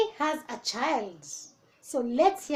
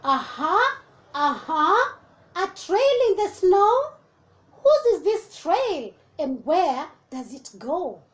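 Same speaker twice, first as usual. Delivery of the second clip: excited discovery; 4 semitones higher, 5 dB louder than usual. Acted. Child finds trail in snow.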